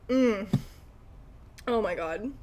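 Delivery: ordinary delivery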